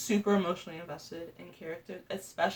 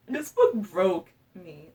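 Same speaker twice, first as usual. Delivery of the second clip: heartbroken; 7 dB louder, much slower than usual. Real, not acted. Emotional reaction to book ending.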